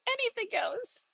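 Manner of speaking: tiny, very high voice; high little singsong, rising at the end; playful squeak. Pretend. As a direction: repeat it in a teasing high squeak. Playful high repeat of line.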